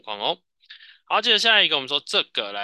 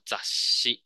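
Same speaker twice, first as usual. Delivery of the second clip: whispered drill. Shown as a demonstration. Teacher drills the word.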